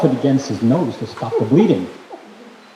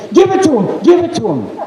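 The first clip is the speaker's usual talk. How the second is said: mom's demand; 8 semitones higher than usual. Retold or imitated. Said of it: "and Mama would say".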